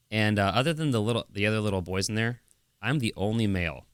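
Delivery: usual speaking voice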